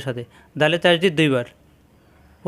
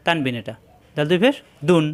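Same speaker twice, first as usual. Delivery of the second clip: ordinary delivery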